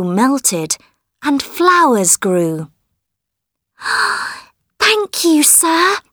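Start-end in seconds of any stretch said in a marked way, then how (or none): 3.8-4.3 high surprised gasp; delighted gasp. Acted. Flowers suddenly grow. 4.8-6.0 put-on high, delighted young girl; grateful girl voice. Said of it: "said Marukla".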